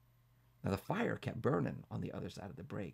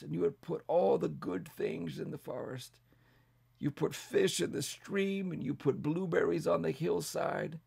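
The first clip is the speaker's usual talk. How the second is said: put-on old bear praying; 7 semitones higher, 3 dB louder than usual. A narrator's reading. An old bear prays to God before the animals, reverent and pleading. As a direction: pray earnestly over the burning forest. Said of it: "And this is what Bartholomew said".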